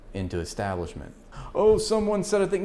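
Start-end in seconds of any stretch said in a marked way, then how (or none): 1.5-2.6 higher, sneering mock of a dismissive critic; high mocking parody voice; mocking opponent. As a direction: parrot the critic with scorn.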